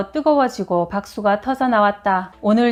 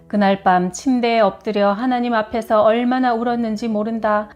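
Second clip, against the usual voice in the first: ordinary talk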